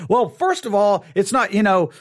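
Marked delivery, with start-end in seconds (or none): none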